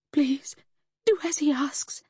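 frightened, very high, thin whispery plea; trembling plea. A narrator's reading. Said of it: "Lucy trembled".

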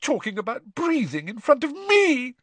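put-on shrill, indignant ghost girl; high, wounded outrage. A narrator's reading. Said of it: "she said shrilly".

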